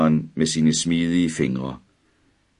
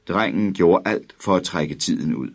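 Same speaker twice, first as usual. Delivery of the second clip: usual speaking voice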